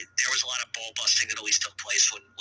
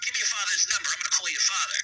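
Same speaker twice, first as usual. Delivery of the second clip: lightly voiced pushy cousin, higher; a hint of the mob cousin; eager cousin; 7 semitones higher than usual. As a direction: retell the cousin's pushy line.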